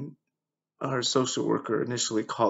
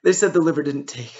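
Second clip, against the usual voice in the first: loud, higher burst of grief; anguished; 5 semitones higher, 9 dB louder than usual. Real, not acted. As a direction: force out the terrible news, higher and louder. Breaking news of failed transplant.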